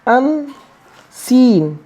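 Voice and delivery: very slowly drawn-out unseen; unseen said slowly with each sound drawn out; slow model word. Shown as a demonstration. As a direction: stretch unseen out syllable by syllable, teaching it. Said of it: "क्या हो जाएगा?".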